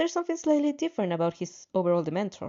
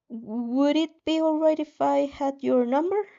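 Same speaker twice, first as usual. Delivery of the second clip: slow, hesitant, higher ask; nervous ask; 5 semitones higher, slower than usual. A narrator's reading. Girl nervously asking Shoto for his number, slow and hesitant. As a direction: screw up your courage and ask. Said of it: "work up the nerve to ask".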